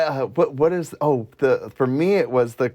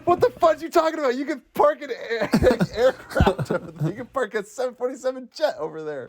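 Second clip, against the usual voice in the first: high, fast, laughing mock rant; exasperated outburst; 11 semitones higher than usual. Retelling the car argument to friends, a high laughing mock rant. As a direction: act incredulous, voice jumping up as you laugh.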